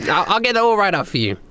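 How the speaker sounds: awkward Heather. Acted. Heather greets old acquaintance, high forced cheer. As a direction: fake friendliness with a nervous laugh.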